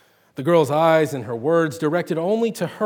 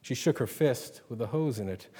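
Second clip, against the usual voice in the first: ordinary delivery, nothing marked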